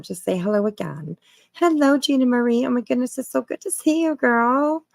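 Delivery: ordinary talk, nothing marked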